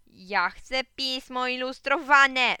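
loud, high, drawn-out whine of a demand; high, slow, loud clamour; demanding child's cry. A narrator's reading. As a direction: call out slowly and insistently, like a kid. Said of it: "zawołałem".

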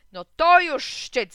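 loud, slow outraged cry; mock outrage, very loud and drawn out; exclaiming. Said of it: "zawołała Jadwinia". (głośny okrzyk oburzenia)

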